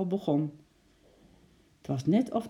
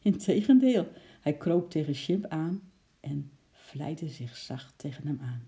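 usual speaking voice

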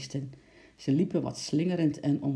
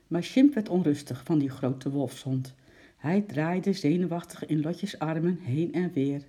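ordinary delivery

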